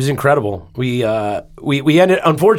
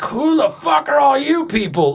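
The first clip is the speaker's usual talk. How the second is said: startled newborn voice; 13 semitones higher than usual. Retold or imitated. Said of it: "and he's like".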